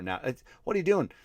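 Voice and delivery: ordinary talk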